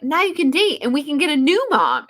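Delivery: a light mock of the little sister's chirpy line. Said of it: "is how she said that".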